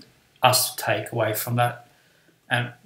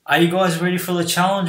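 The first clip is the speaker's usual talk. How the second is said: lightly voiced teacher, pitched higher; a hint of an egging-on teacher; winding up the class; 5 semitones higher than usual. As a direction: hype the pupils with a challenge. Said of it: "good at winding us up".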